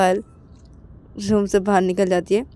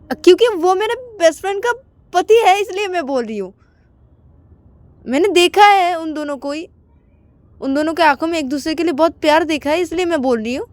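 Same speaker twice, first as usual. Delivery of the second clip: much higher, louder fast defence; defensive friend; 9 semitones higher, 4 dB louder than usual. Acted. Payal defending her words, higher and louder. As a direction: insist passionately.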